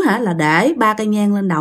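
ordinary delivery

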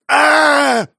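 high, loud, sustained scream; man's terrified scream. A narrator's reading. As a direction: scream as you bolt. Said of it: "Мужчина закричал". (крик ужаса)

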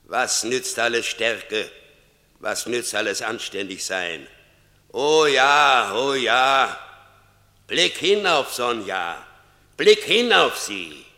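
towering voice of Death, high and loud; grim reaper voice. Acted. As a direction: Death thundering judgment. Death confronts Franz.

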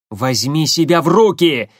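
громкий резкий окрик; осаживает криком; прикрикнул. Acted. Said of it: "прикрикнул на подвывающего ... водителя Корнилов". (резко прикрикнуть)

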